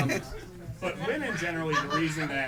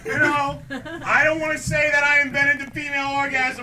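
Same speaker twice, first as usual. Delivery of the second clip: high, loud boastful bro voice; cocky lab guy; 11 semitones higher, 10 dB louder than usual. Retold or imitated. Newark sex lab guy bragging about his invention, loud cocky. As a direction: brag it loud to the camera. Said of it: "I just imagine a guy like".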